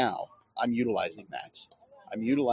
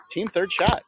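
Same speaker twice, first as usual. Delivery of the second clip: excited; 10 semitones higher, 8 dB louder than usual. Real, not acted. Celebrating the win.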